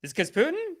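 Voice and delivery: exaggerated mock-innocent rise on Putin; sarcastic question. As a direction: ask it in a squeaky fake-naive voice.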